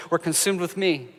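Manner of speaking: ordinary delivery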